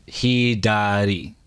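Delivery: learner's slow "Hidari", syllable by syllable; very slow, careful "Hidari". Shown as a demonstration. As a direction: stretch "Hidari" out as hee-daa-dee. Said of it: "I'm saying it slow".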